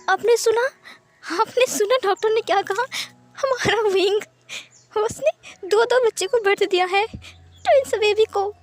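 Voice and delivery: high, teary, overjoyed voice; tearful joy. Acted. Mother tearfully telling her husband about the twins. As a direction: cry with happiness as you share it. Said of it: "madam लान की आंखों में आंसू आ गए".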